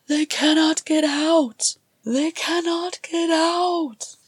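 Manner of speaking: high, loud panicked shout, slowing at the end; desperate shouting. Acted. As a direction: yell it over and over in terror. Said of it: "his shouts rang through the halls".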